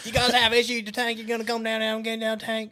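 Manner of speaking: very high hammed-up twang; mock Southern accent. Friend imitating call about tank issues, high Southern accent.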